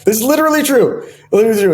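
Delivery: high-pitched, laughing insistence; real laughing disbelief. Real, not acted. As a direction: swear it's true while cracking up. Laughs at own absurd story.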